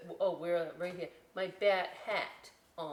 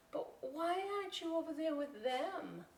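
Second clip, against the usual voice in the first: put-on curious kid voice; 8 semitones higher than usual. Ophelia character voice.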